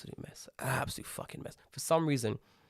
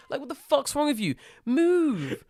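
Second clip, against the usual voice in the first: venting outrage, pitched way up; furious rant; 12 semitones higher than usual. Retold or imitated. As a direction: rage at the lazy staff. Ranting at lazy staff.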